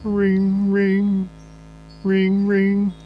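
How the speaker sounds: high, even sung-out phone ring; imitated phone ringing.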